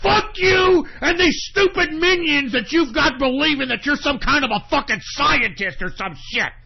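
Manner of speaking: screaming in real rage, high and loud; real furious screaming rant; furious shout. Real, not acted. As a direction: yell the insults with all your fury. Cursing Elon Musk in rage.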